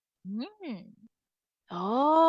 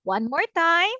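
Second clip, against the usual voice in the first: normal talking voice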